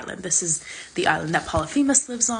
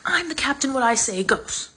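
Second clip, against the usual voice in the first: bossy; 7 semitones higher than usual. Retold or imitated. Odysseus pulling rank.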